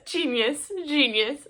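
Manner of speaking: playful sing-song "Bu-bu-bu, genius"; lightly sung self-scolding; mock self-warning. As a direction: tease yourself away from the bookstore. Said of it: "I can go".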